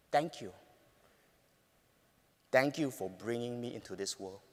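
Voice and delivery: soft, low, sincere thank you; tender thanks. Real, not acted. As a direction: say it with deep gratitude. Heartfelt text to mother.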